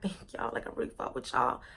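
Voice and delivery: whispered, soft thanks; hushed thanks. Retold or imitated. Thanking the friends who stayed loyal, whispered. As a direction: breathe it out to your friends. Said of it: "I was like".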